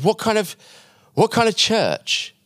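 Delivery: high-pitched, drawn-out disbelief; acted astonished challenge, pitch leaping up; incredulous. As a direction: question him as if baffled. Cafe guy challenges him, puzzled.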